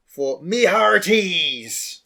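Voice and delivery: slow, drawn-out pirate voice. Acted. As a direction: growl it like a pirate captain. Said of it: "I will be doing the pirate voice".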